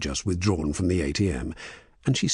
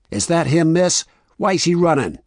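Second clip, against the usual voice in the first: loud, high questioning; raised voice of a cop in pursuit; urgent calling out; 11 semitones higher, 7 dB louder than usual. A narrator's reading. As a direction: call it out sharply and urgently.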